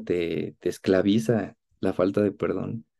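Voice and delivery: ordinary talk, nothing marked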